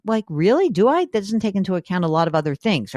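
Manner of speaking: usual speaking voice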